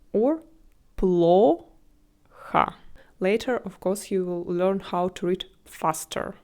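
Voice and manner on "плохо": плохо as a long rising пло, pause, then ха; syllable by syllable. Shown as a demonstration. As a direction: split плохо after the first three letters. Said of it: "make the first syllable, uh, with three letters".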